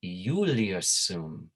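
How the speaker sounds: "Iulius" slowly said as YOO-lee-us; old Latin pronunciation. Shown as a demonstration. Teacher models the old way of saying the name, "Iulius" as YOO-lee-us. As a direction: start "Iulius" with a Y glide, drawn out. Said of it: "this is the old way of saying the name".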